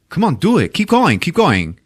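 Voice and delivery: insistent loud encouragement, slowing down; loud urging. Retold or imitated. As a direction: drive him on like a pushy director. Tommy loudly urging Greg on.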